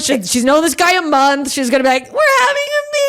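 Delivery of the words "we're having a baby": high-pitched, gleeful squeal; mock gushing announcement. Imagined new girlfriend announcing a pregnancy, mock squealing. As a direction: put on an over-the-top, very high excited voice.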